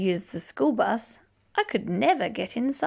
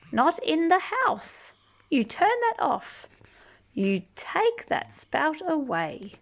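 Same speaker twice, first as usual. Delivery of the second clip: higher, sharp mother's scold; mock scolding mother; 7 semitones higher than usual. Mother telling the child to turn the spout off, high mock scold. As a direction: play the fussing mother.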